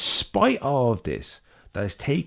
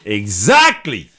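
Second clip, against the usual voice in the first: triumphant; 10 dB louder, much slower than usual. Real, not acted. Agreeing after the crowd answer.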